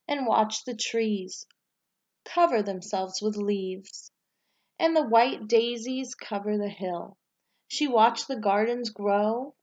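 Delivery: put-on reading a picture book aloud to children, lively and rising at each new season; bright, expressive read-aloud storyteller voice; story narration. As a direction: tell it like story time, lifting the start of each season. Teacher reads storybook aloud.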